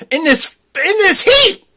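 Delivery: high, loud disbelief; can't believe it, voice jumps up; incredulous. Real, not acted. Disbelief at coat in heat.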